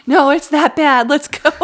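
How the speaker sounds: high, loud retort; comic frantic dad, shouting; mock exasperated shout. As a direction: overrule the kid in a played-up yell. Acted argument with the kid.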